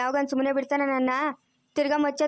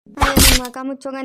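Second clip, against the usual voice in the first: loud exasperated curse, much louder; angry outburst; 9 dB louder than usual. Acted. Grandmother snaps at granddaughter who blames her, loud angry curse. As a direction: bark the curse in a furious burst.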